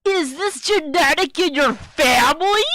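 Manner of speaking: loud, high-pitched disbelief; outraged question. Acted. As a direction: shout it as an incredulous question, voice climbing at the end. Acted outraged question in comic.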